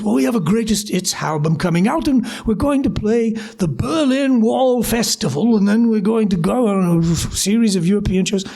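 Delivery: plummy English accent impression. English bassist selling tour plans to a recruit, plummy English accent. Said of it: "really, really plummy sort of English accent".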